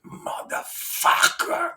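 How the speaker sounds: very slow, drawn-out dad voice; imitating his dad. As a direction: stretch it out like his dad used to. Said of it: "that's how he talked".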